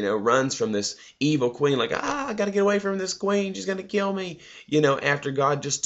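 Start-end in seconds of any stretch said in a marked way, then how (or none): 1.9-4.4 high, whiny mock panic, pitched well up; mock a cowardly prophet running scared; whiny scared Elijah. Mocking Elijah fleeing the queen.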